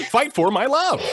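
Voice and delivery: high, loud demand as a hammed-up dad; disappointed father. Stand-up audience as father to comic, loud disappointed demand. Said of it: "disappointed father".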